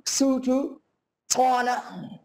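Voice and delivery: normal talking voice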